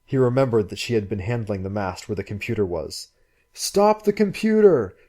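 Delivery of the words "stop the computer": frantic shout. Acted. Frantic shouts at drifting boat.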